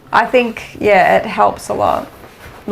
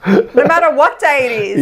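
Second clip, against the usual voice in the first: said through a laugh, higher; 6 semitones higher than usual. Real, not acted. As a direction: giggle through the tease. Teasing while laughing.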